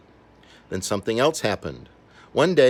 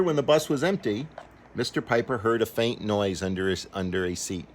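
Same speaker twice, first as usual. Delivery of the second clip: plain reading voice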